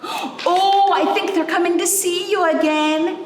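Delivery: high and bright with hope; a child thrilled the crowd is back; excited girl's voice. Acted. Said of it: "Ava said to the rainbow".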